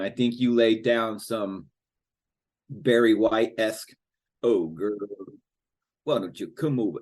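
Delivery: usual speaking voice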